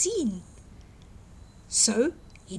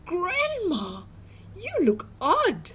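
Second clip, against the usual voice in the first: put-on higher, wondering girl voice; puzzled little girl; 8 semitones higher, slower than usual. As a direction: sound unsure and curious.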